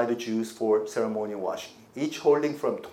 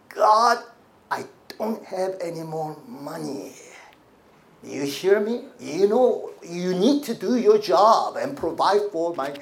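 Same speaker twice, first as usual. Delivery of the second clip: mock demanding prayer, raised voice; pushy; 3 semitones higher, 4 dB louder than usual. Believer ordering God to provide money, pushy. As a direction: push hard, as if ordering someone to deliver. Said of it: "Have you ever been pushy with God?".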